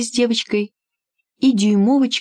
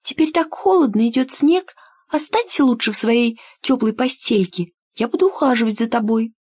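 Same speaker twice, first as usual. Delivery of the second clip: put-on higher, caring little girl; 5 semitones higher than usual. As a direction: coax the bird gently, girlishly. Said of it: "сказала девочка".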